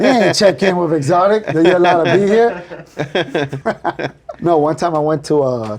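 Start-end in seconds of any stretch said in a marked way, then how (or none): 0.0-4.1 loud, higher, through laughter; mock-scold them while cracking up; joking reprimand. Owner teases visiting friends about checking in, laughing.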